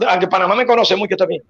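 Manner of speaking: usual speaking voice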